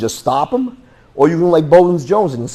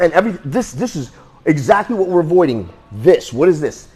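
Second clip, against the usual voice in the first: ordinary talk, nothing marked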